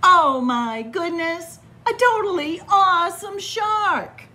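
hammed-up self-admiration; mock amazement. Shark admiring himself.

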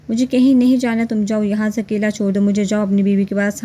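ordinary delivery

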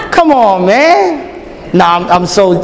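normal talking voice

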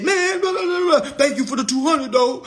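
high mocking voice of the guy; mocking him. Imitating the guy who got paid, high mocking tone. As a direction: mimic him in a high sneer. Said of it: "he said".

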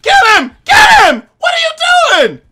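very high, loud panicked shouting; frantic calling. Real, not acted. Gamer yelling for teammate Shocky to help.

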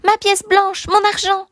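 put-on very high, urgent little-boy voice; child's cry. A narrator's reading. Child crying for his stolen money, high urgent cry. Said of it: "cria l'enfant".

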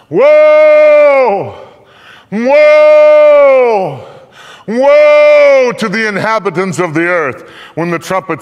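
very high, very loud wailing cry. A narrator's reading. Eagle in mid-heaven crying out its warning, very loud and high. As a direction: howl the warning across the sky. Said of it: "calling with a loud cry".

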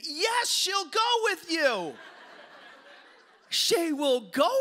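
high, loud, drawn-out yes; hammed-up excited mother shout; mock eager outburst. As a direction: burst in shouting like an overeager mom.

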